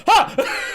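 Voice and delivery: high, loud startled "Ha!"; sudden yelp at a jump scare. Retold or imitated. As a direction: re-enact jumping in fright. Retold fright at jump scare.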